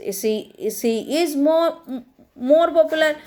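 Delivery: each word spaced out slowly for the class; slow dictation. Shown as a demonstration. Teacher to her students, slow dictation. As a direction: dictate it slowly so children can write.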